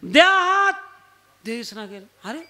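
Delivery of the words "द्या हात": higher, raised demanding call. Retold or imitated. Rescuer asking trapped man for his hand, calling out. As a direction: shout it down to someone stuck below.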